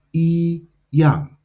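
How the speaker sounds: yi yang with yi high and level, yang falling; dictionary tones. Shown as a demonstration. Teacher gives the dictionary form, yi yang with high level yi and falling yang. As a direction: hold yi in first tone, drop yang in fourth. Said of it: "yi in first tone, yang fourth tone".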